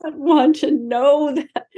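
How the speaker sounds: high, slow, half laughing, half crying; choked laugh as the words come out slowly; laughing through tears. Real, not acted. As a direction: break between a laugh and a sob. Hearing the cremation date.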